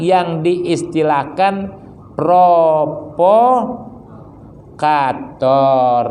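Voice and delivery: pelan dan memanjang, tiap kata ditarik; lambat membacakan dalil. Acted. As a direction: bacakan dalil dengan sangat lambat dan khidmat. Membaca dalil lalu menjelaskan. (pembacaan dalil yang sangat lambat)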